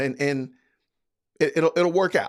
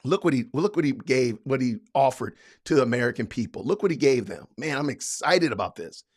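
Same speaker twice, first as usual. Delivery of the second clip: sarcastic mock fan; 5 semitones lower, 3 dB quieter than usual.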